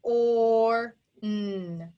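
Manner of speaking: "Or, n" as separate held sounds, level in pitch; sounding out. Shown as a demonstration. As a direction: stretch each sound of "Or, n" apart. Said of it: "Tap it out".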